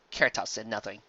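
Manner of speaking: usual speaking voice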